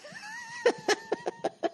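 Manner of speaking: high squeaky helpless laughter; breathless high giggling fit; wheezing laugh. Real, not acted. Laughs at the Prius insult.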